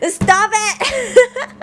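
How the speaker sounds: loud high laughing stop it; giggling outburst; laughing protest. Real, not acted. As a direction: yell it through laughter. Protesting at teasing chat.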